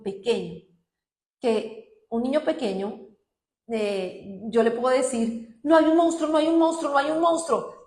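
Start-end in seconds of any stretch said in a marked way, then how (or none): 5.6-7.8 high, loud, rushed "no monster"; frantic, too-loud comfort to a child; hurried reassurance. Retold or imitated. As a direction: reassure while panicking yourself. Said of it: "si yo sigo así, acelerada".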